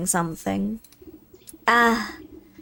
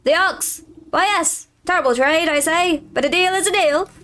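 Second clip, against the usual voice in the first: a grand, eager scarecrow hailing you; 6 semitones higher than usual. Acted. Scarecrow speaking.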